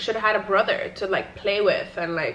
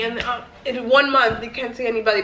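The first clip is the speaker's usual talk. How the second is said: ordinary delivery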